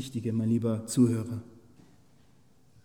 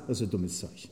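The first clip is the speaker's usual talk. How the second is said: dropped low and soft, scornful; soft scornful dismissal; dismissive mutter; 5 semitones lower, 8 dB quieter than usual. Real, not acted. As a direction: toss it off quietly, lower. Dismissive aside.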